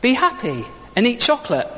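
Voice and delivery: high sing-song slogan; chirpy Easter Bunny answer. Acted. Preacher voicing the Easter Bunny's answer to bereavement, blithely chirpy.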